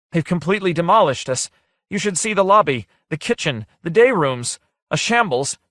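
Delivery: high, loud and breathless with alarm; agitated report. Acted. Holpers bursting in to tell the captain, frantic and high. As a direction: blurt the damage out in a frantic rush.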